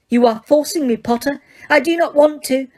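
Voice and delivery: high, firm enraged witch; clipped fury held in check; Umbridge voice. Acted.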